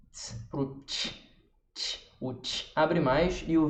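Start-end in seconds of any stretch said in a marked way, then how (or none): 0.1-2.0 "ts" then "tch", short hissed demo sounds; som de ts e tch. Shown as a demonstration. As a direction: contrast "ts" with the open "tch". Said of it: "Olha como a minha boca fica diferente do ts". (sons "ts" e "tch" soprados)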